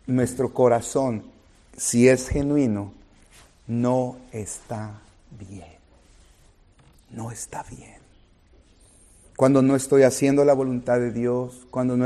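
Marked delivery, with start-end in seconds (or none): 3.7-5.8 slow, heavy-hearted no está bien; somber, slowed lament. Acted. Preacher to congregation, grieving the broken heart, slow and somber. 7.1-8.0 near-whispered repeat, much softer; hushed echo. Acted. Preacher echoing himself to the church, barely above a whisper.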